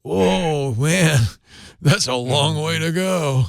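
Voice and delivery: slow lazy stoner drawl. Acted. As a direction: stretch it out like a stoned hippie. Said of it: "he had this stoner voice".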